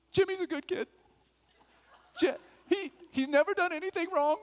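high mom voice, earnest pleading. Retold or imitated. Mother at the court mic pleading for her son, high and earnest. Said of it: "And my mom says".

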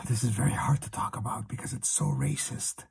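breathy hushed confession; whispering under a desk. Pretend. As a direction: whisper it as if someone might hear. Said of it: "I'm hiding under the desk".

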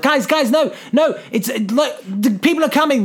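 mock-urgent pleading; urgent warning. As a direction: retell a frantic warning to the team. Urgent radio warning in retold film.

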